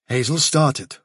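plain reading voice

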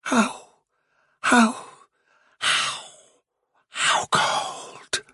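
soft, broken, trance-like murmur; muttered. A narrator's reading. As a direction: murmur it in drifting fragments, fading to whisper. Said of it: "muttered Fiver".

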